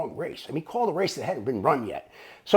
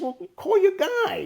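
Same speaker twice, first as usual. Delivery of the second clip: high, drawn-out prodding; retold urging; 3 dB louder than usual. Chuck tells the clerk to place a bet, high insistent urging. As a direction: imitate a pushy friend, pitched up.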